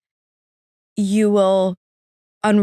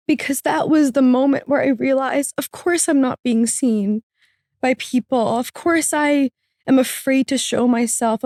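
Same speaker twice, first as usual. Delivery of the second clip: higher voice, welling up; real emotion lifting the voice high; genuinely emotional; 5 semitones higher than usual. Real, not acted. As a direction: tell it while holding back tears. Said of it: "I'm gonna get emotional talking about it".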